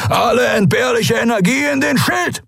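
very high, full-throated battle shout; bellowed emergency order; roared command. A narrator's reading. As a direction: roar it to the engine room. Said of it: "brüllte Askur".

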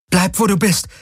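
high, tense shaky warning; frightened girl holding it off; scared warning. A narrator's reading. Threatening the creature with laser.

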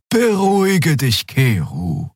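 high, slow, calming voice; soothing Xea. A narrator's reading. Xea calming an angry crewmate, slow and soothing. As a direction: soothe slowly, pitched high. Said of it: "Xeas Stimme war wie Balsam".

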